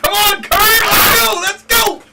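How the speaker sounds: loud excited cheering; shouting. Real, not acted. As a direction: yell to urge it on. Cheering the cart on.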